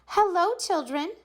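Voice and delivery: high, sweet and kind greeting. A narrator's reading. Beloved teacher returns, greeting kids sweetly. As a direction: greet the children tenderly. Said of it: "someone said in a sweet voice".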